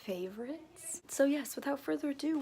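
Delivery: ordinary talk, nothing marked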